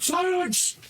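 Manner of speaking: high-pitched yell, no real volume; acted yell. Captain cuts off the squabbling group, high acted yell. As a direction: cry out high to cut everyone off. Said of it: "Finally, he just yells out".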